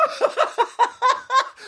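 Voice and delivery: very high, squealing cackle; hammed-up falsetto laughter; high mock laugh. Mocking the opener's news.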